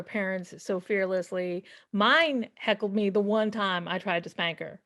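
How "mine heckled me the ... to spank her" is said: ordinary delivery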